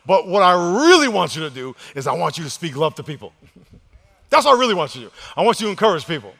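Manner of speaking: loud, high-pitched rousing preacher; full-force preaching. Real, not acted. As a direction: belt it out to rally the congregation.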